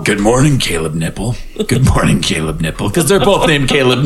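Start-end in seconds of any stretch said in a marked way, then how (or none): 0.0-1.4 deep silly voice. Pretend. Greeting nipples, deep voice. 1.7-2.9 deep silly voice. Pretend. Second nipple greeting.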